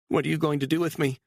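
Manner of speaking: high, rushed and scared; frightened woman, fast. A narrator's reading. Captive woman breaking the silence, high and rushed. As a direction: blurt it out in fear. Beatrice voiced by narrator.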